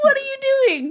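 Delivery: very high, wailing disbelief; mock exasperated shriek. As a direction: wail it in hammed-up dismay. Exasperated reaction to a bad joke.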